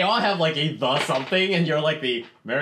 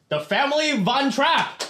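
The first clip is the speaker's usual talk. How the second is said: drawn-out booming announcement; grand announcer; 11 semitones higher, slower than usual. Acted. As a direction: proclaim it like a herald. Joking announcement.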